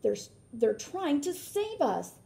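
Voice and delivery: gleeful shout. Acted. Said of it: "he shouted with glee".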